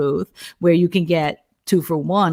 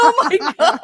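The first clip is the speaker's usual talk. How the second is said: mock husband dismay; 12 semitones higher, 3 dB louder than usual. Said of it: "He's like".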